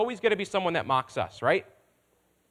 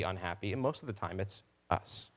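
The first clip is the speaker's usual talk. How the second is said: much softer and lower; hushed aside; 10 semitones lower, 10 dB quieter than usual. Real, not acted. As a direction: drop to a near murmur. Confession drops to near silence.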